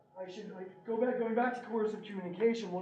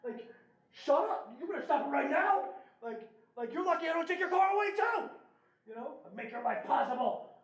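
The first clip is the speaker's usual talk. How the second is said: pretend angry dad; 6 semitones higher than usual. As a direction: snap at her like an angry parent. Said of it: "I could have been like".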